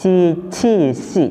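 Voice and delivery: "Ci, ci, si" with sibilant hiss on each onset; slow, spaced "Ci, ci, si" with hissing starts; hissed consonants. Shown as a demonstration. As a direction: hiss through "Ci, ci, si", second "ci" puffed. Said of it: "Selalu dibaca".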